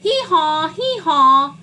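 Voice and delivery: donkey's hee-haw. A narrator's reading. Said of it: "yelled the donkey".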